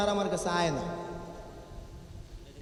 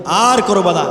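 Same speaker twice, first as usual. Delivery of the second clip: stern father; 12 dB louder, slower than usual. Retold or imitated. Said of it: "তখন আব্বু বলে কি".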